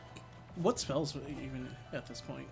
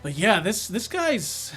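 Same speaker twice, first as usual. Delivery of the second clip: loud exclaim; 7 semitones higher, 12 dB louder, slower than usual. Real, not acted. Frustrated about the boss.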